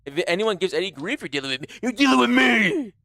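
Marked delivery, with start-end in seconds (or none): 1.8-2.9 drawn-out, high hammed-up warning; put-on tough protector, slow and high; mock threat. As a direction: stretch it into a playful menacing warning. Mock threat defending the viewer.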